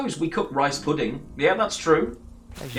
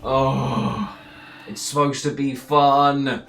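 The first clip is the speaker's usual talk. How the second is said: exasperated groan; 4 dB louder, much slower than usual. Real, not acted. Groaning at over-serious players.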